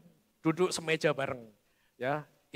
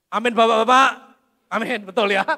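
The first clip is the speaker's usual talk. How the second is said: much louder, ringing repeat calling to the men; 2 semitones higher, 9 dB louder than usual. Shown as a demonstration. Preacher to the men in the crowd, loud teasing amen. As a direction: call the fathers out, loud and teasing.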